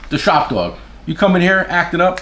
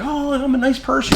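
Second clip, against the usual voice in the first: mock nice guy; 9 semitones higher than usual. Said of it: "and he's like".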